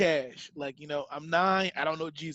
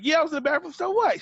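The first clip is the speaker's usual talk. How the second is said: put-on defiant kid; 8 semitones higher, faster than usual. Kid defiantly answers accusing lady.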